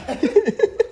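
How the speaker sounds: cracking up; loud laugh. Real, not acted. Friends joking about age, loud laugh. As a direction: burst of high laughter.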